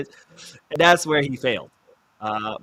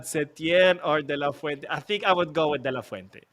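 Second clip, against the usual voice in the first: usual speaking voice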